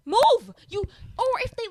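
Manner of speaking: high, loud barked order; frustrated shopper yelling move; shout. Retold or imitated. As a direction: yell at them to clear the aisle.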